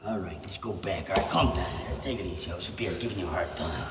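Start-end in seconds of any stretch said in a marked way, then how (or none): 0.0-1.1 hushed whisper. Acted. Sketch scene, calming someone. 2.0-3.9 low, soft and soothing; hushed soothing. Acted. Sketch scene, calming upset woman.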